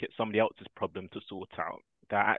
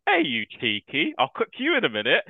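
playful mock threat; 8 semitones higher, 6 dB louder than usual. Uncle playfully threatens his niece.

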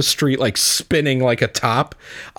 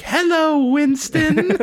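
very high, sing-song greeting; giddy Tracer; much slower than usual. Retold or imitated. Tracer giddily greeting Winston, very high sing-song. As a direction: greet him like a delighted kid. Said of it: "she's just so giddy".

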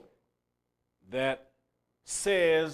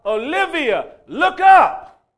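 loud high yell of a warning; 10 semitones higher, 11 dB louder, much slower than usual. Retold or imitated. Preacher yelling to Olivia to look up, loud and high. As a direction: shout it to make her look up. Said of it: "if I would have yelled out".

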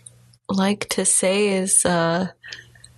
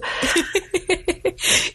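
laugh; 10 semitones higher than usual. Real, not acted. Laughs after thanks.

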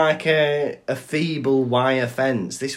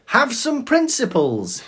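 high-pitched mimicry of an indignant dealer; mock drug dealer; 11 semitones higher, 3 dB louder than usual. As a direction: parody the dealer's outraged cry. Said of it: "said the drug dealer".

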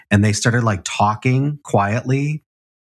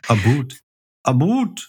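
put-on playful Canadian accent, second "boot" higher; mock Canadian accent; 3 semitones higher than usual. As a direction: echo the Canadian "boot", pitching it up.

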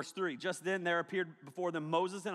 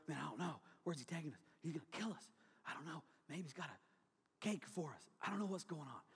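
hushed, worried grumbling under the breath; nervous disciples muttering; 2 semitones lower, 12 dB quieter than usual. Acted. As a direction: mutter it like scared friends on a hike.

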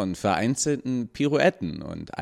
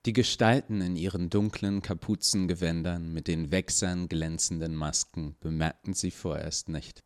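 ordinary delivery, nothing marked

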